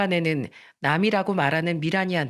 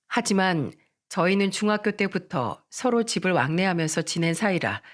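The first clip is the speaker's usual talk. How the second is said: ordinary delivery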